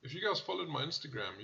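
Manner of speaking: normal talking voice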